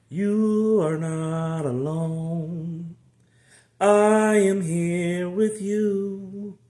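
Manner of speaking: very slow, high, sung-out comfort; slow drawn-out reassurance. Real, not acted. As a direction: stretch every word to comfort.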